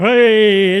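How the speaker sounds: loud, slow bingo hall call; bingo caller. Acted. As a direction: boom it out like calling numbers. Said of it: "that was a bit like bingo caller".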